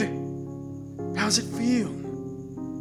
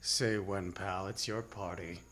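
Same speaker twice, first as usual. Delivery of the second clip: quiet menace; 2 semitones lower, 13 dB quieter, slower than usual. Acted. Gunman to captive at gunpoint, quiet menace. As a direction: drop right down, coolly threatening.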